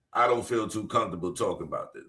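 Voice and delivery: mocking quote. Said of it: "Look how he told that story".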